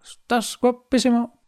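high, slow flattering dog voice; lightly mocking dog compliment. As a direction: coo like a doting dog. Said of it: "Cada vez que me ve dice".

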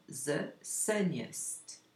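the sunniest, slow and clear; slow model word. Shown as a demonstration. Teacher showing the superlative to learners, slowly. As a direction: stretch out the sunniest. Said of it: "par exemple".

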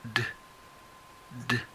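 "d" said as a short voiced d; teacher's model for repetition; bare d sound. Shown as a demonstration. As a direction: model "d" with no vowel. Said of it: "La segunda es d".

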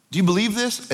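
ordinary talk

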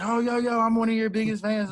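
hammed-up starstruck fan calling out; mock fan yell. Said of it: "yelled at somebody and be like".

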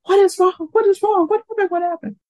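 high-pitched repeated what happened; high worried boss. Retold or imitated. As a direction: imitate a frantic boss asking what's wrong. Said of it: "she go like".

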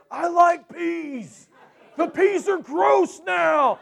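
high, slow, shouted whine over the peas; hammed-up complaining about ruined peas; young girl shrieking that the peas are gross; mock whiny Stacy. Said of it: "she's going off on me about these peas".

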